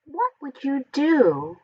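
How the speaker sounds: ordinary delivery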